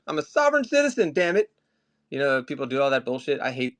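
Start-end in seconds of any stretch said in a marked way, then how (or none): 0.1-1.5 hammed-up shouting of a man being arrested; mock yelling. Pretending to be arrested, yelling at police in mock outrage. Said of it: "I was, like, yelling".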